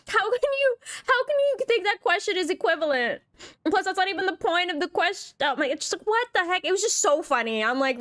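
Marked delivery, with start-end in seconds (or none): 0.1-6.6 high, cracking exasperation; pitched-up frustrated rant; disbelieving. Real, not acted. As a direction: near laughter in disbelief.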